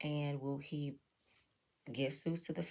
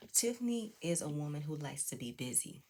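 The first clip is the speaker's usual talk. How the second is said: ordinary talk, nothing marked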